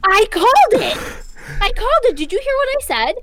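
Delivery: high-pitched, excited "called it"; excited triumph. Real, not acted. Listener guessed the movie before the reveal, excited and high-pitched. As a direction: crow about guessing it first.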